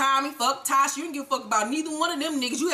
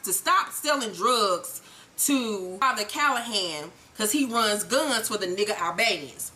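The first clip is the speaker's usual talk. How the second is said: ordinary delivery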